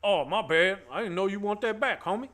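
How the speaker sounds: bully from Friday. Retold or imitated. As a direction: play dumb like a smug bully. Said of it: "my best, uh, Friday impression".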